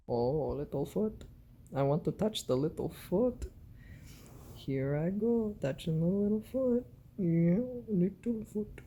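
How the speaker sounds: soft high sing-song pet voice; cooing pet talk. Real, not acted. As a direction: coo over the little foot. Cooing over cat's paw.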